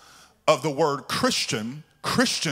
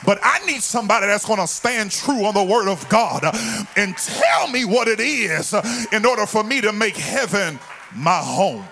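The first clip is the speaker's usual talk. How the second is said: loud, driving church sermon delivery; fired-up pulpit preaching; full-force preaching; 3 semitones higher, 3 dB louder than usual. Real, not acted. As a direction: preach it hard, hitting the key words.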